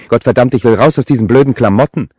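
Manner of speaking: loud, high, exasperated outburst; cursing at full volume; furious. A narrator's reading. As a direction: explode in anger at your brother. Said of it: "Sie trat wütend".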